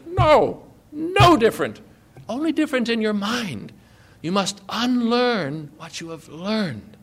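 a hint of a wise old sage; Yoda voice. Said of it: "And Yoda, the old master, says".

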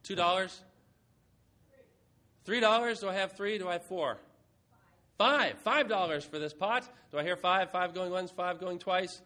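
loud rising calls for bids; auctioneer voice. Pretend. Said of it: "This is mock auction".

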